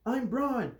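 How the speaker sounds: high, tense threatening voice; angry man in a boy's body, threatening; angry threat. A narrator's reading. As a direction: threaten him through clenched anger. Angry teacher threatens in dark.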